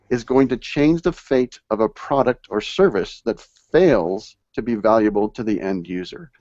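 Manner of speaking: ordinary talk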